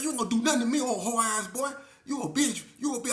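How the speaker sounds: high, loud taunting yell. Retold or imitated. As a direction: dare him, loudly. Taunting during fight.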